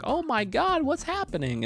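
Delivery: very high-pitched imitation of panic; hammed-up shocked onlooker; mock alarm. Family at the apartment window seeing Bowser, mock alarm. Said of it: "their heads are peeking out".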